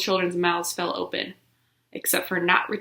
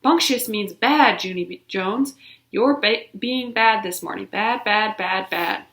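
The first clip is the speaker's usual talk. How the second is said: wag the finger with every "bad"; bossy classmate chanting bad bad bad; tattling scold; 3 semitones higher, 5 dB louder, slower than usual. May scolding Junie B.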